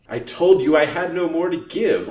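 very loud, high, desperate outburst; almost shriek it in desperation; desperate near-shriek. A narrator's reading. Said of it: "almost shrieked Missus Vance in her desperation".

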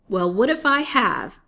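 high, loud defiant retort; snapping. A narrator's reading. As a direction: snap back without shame. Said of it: "snapped he".

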